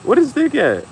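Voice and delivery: high, loud eager call; hyped shout. Pretend. Parent hyping kid, asking where Stick is. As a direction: shout it out, all fired up.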